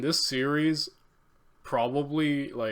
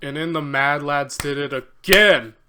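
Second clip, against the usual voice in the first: excited shout; 2 semitones higher, 10 dB louder than usual. Real, not acted. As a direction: burst out cheering over the video. Excited viewer calls out over video.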